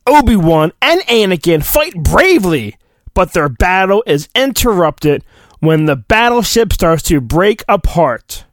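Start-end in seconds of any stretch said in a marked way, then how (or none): none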